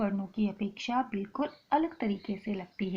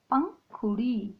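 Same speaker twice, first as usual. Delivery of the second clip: slow word demo; much slower than usual. Shown as a demonstration. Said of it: "प पे अनुनासिक की मात्रा लगी तो पंग".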